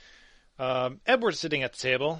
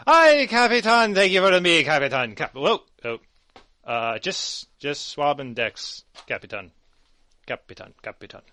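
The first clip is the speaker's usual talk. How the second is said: put-on tipsy sailor girl; 7 dB louder than usual. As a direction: greet the captain merrily drunk, then trail off. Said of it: "slurred and tipsy".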